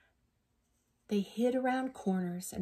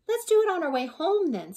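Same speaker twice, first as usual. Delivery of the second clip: much higher, louder eager voice; an eager little suggestion; little skeleton; 10 semitones higher, 9 dB louder than usual. Acted. Little skeleton proposes a scare on the way home, much higher. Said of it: "said the little skeleton".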